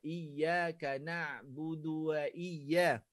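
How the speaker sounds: iyaka na'budu wa iya very slow; model recitation. Shown as a demonstration. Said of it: "Boleh faham?". (bacaan contoh perlahan)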